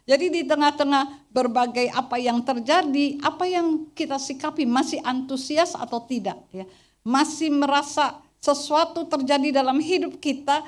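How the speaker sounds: loud, driving sermon voice; preaching at full force. Acted. Pastor's wife exhorting the congregation, loud and driving.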